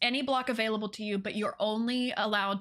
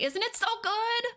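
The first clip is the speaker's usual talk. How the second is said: gushing; 12 semitones higher than usual. Real, not acted. Gushing over a build.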